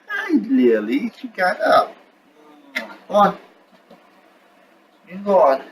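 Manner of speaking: sweet high voice for a pet dog; talking to the dog. Real, not acted. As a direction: coo at the dog as she wakes up. Talking to his dog Lily.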